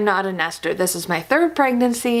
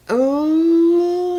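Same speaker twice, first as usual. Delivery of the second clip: pained high ooh; 9 semitones higher than usual. Real, not acted. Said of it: "my head is pounding".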